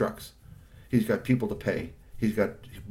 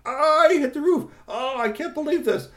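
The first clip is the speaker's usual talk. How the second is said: very high, louder outraged cry; furious uncle; 7 dB louder than usual. Acted. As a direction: explode at the nephew. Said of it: "Mister Kamakura-san hit the roof".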